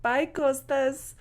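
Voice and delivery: high, drawn-out little farewell; retold greeting, high and slow; retold shy goodbye. Greeting the celebrity while getting off.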